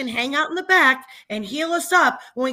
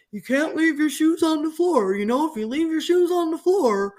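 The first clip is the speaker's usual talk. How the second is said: higher, slightly mocking lecturing husband; 4 semitones higher than usual. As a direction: parrot the husband's nagging lecture. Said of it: "he's going".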